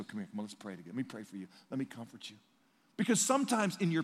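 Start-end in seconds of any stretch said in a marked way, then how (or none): 0.0-2.4 soft, low, tender comforting; gentle comfort. Retold or imitated. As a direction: hold a grieving friend close with words. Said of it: "I just go".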